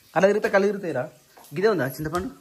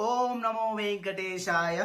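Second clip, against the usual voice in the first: devout prayer; 3 semitones higher, 8 dB quieter, much slower than usual. Real, not acted. Morning prayer greeting.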